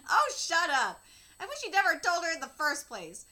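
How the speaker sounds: patient snaps back at the laughing visitor; annoyed snap. Acted. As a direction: bite back at the teasing.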